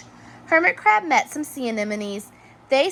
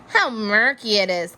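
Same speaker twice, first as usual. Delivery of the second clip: ordinary delivery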